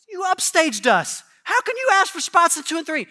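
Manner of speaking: high, loud indignant complaint; upstaged and annoyed, making it heard; indignant gripe. Retold or imitated. Disciples griping at James and John, loud and indignant. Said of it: "They're like".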